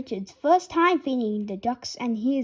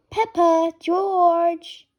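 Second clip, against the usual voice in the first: higher and louder call; 5 semitones higher, 5 dB louder, much slower than usual. Acted. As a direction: call the kids over. Mother calling children.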